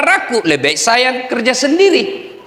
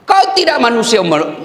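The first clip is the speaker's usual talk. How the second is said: ordinary delivery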